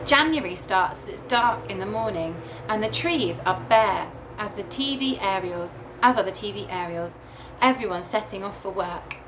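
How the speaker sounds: ordinary delivery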